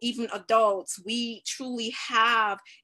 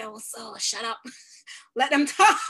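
scolding herself; 5 dB louder than usual. Retold or imitated. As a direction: hush yourself under your breath: "Oh, so shut up.". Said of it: "sometimes I say".

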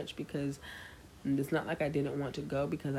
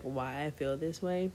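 ordinary delivery

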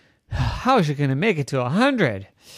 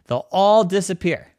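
ordinary delivery, nothing marked

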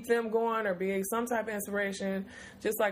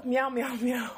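higher, lightly mewing like a cat; playful meow; 4 semitones higher than usual. Pretend. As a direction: toss off a silly cat meow.

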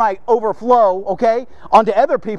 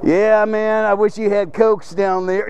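lightly voiced lounge lizard; a hint of a kicked-back, overfamiliar slacker. Employee lounging in the boss's office, lightly mocked slacker. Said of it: "I'm not being the lounge lizard".

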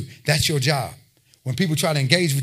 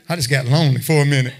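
laughing at himself. Real, not acted. Pastor joking to the congregation about loneliness, laughing. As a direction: chuckle at your own joke.

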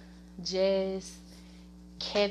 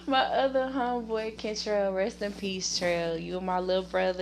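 usual speaking voice

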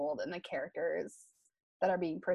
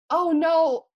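high and loud, brushing it off; a big bright "oh no" to wave the worry away; emphatic denial; 9 semitones higher, 10 dB louder than usual. Retold or imitated. Telling her worried friend it won't be sad, loud and high.

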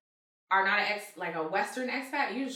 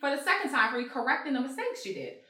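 higher, louder indignant retelling; a woman reenacting her fight with a lazy painter, heated and raised; mock angry retort; 7 semitones higher, 4 dB louder than usual. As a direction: replay the argument, heated and higher.

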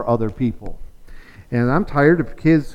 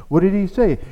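ordinary talk, nothing marked

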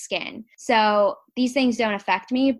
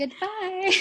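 sing-song goodbye; 9 semitones higher than usual. Pretend. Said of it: "playful goodbye".

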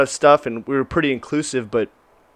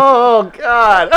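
loud, high-pitched "oh God" reaction; dismayed exclamation; 9 semitones higher, 6 dB louder than usual. Real, not acted. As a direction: cry out in amused dismay. Listener reacts to friend's betrayal story.

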